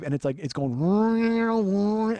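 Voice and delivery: whining hum. Retold or imitated. Imitating lightsaber whine.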